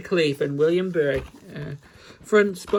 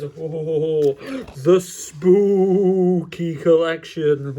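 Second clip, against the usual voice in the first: drawn-out haunting announcer voice; spooky voice; much slower than usual. Pretend. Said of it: "it's in a spooky voice".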